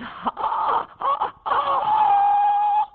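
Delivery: wild shrill cackle breaking high; shrieking high laugh. Acted. Wild laugh after crab bit.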